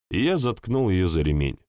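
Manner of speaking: usual speaking voice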